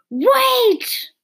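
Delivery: sharp high shout of Wait; calling out. Acted. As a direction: cry out to stop her. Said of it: "a voice called out".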